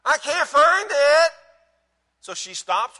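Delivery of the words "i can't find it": loud, high, drawn-out whine; hammed-up whiny complaint, slow and high; whiny husband. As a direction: whine it back like a helpless husband. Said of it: "in that typical husband whiny voice".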